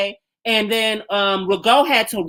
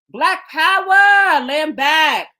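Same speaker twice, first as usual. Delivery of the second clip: rally call; 7 semitones higher, much slower than usual. Real, not acted. Rallying chant.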